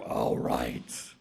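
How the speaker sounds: deep, soft rasp; very low, slow snake assent; great python. Acted. Python agrees to be measured, deep and soft.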